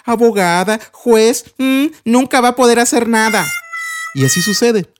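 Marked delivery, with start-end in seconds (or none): 0.0-3.5 higher, sneering jeer; bully mocking Pedrito's dream; mocking tease. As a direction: laugh at the kid's dream. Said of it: "O tal vez".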